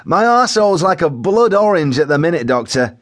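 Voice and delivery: high-pitched, unembarrassed wailing complaint; Joe Windass, distressed shout. A narrator's reading. Joe lists his symptoms to the paramedic, shouting in distress. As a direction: tell it like it is, in distress. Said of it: "he shouted, as if in distress".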